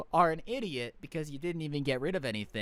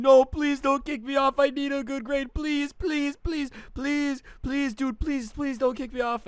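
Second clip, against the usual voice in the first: higher mock-crying plea, a bit louder; crying like a baby; 7 semitones higher, 4 dB louder than usual. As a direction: whine and beg like a crybaby kid. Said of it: "starts crying like a little baby".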